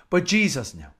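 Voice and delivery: usual speaking voice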